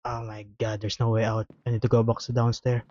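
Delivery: ordinary talk, nothing marked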